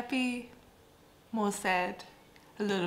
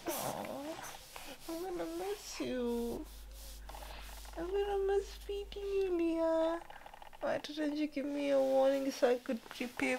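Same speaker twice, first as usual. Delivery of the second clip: much higher, soft, aching voice; 7 semitones higher, 3 dB quieter than usual. Real, not acted. Mother talking tenderly to baby.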